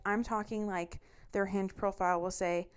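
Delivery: ordinary talk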